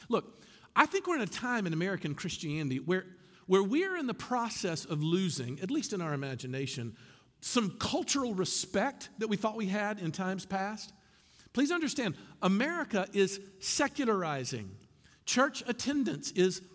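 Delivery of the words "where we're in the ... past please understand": normal talking voice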